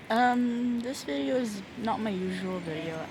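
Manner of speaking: ordinary talk, nothing marked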